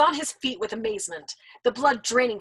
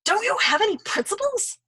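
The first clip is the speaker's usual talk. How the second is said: higher, louder indignant question; indignant young man; 11 semitones higher, 4 dB louder than usual. Acted. Nately challenging the old man, high indignant pitch. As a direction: demand it in disbelief.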